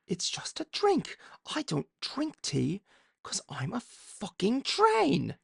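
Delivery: high, slow, creepy British train voice; evil Thomas voice. Pretend. Voicing his drawn demon Thomas to viewers, high and slow. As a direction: play the cursed train promising to eat souls. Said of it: "I'm Thomas the Tank Engine".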